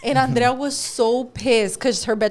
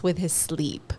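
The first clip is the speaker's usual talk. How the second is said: whispering; 8 semitones lower, 7 dB quieter, slower than usual. Real, not acted. Whispered aside about cranky boyfriend.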